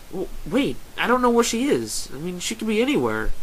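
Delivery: high, panicky protest; flustered and rising in alarm; panicked. A narrator's reading. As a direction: stammer into a high-pitched panic. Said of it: "A sense of panic fills your voice".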